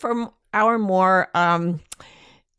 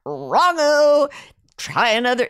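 loud, higher mock dismissal; mock brush-off; 3 semitones higher, 5 dB louder than usual. Telling the psychic to try again, mock loud. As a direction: toss it off like a fed-up client. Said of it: "you can't just say".

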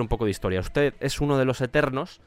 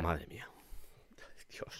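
whispered; 9 semitones lower, 20 dB quieter, slower than usual. Real, not acted. After an awful joke.

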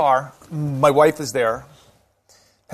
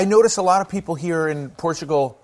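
ordinary delivery

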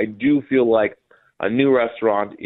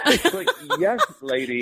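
laughing. Retold or imitated. Mocking own reply to hostess.

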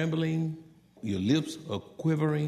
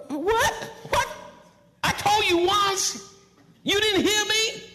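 high, loud parental yelling; shouting and screaming; 5 dB louder than usual. Shown as a demonstration. Angry parent not being heard, screamed scolding. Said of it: "Shouting and screaming".

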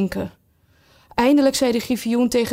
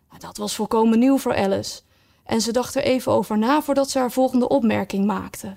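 plain reading voice

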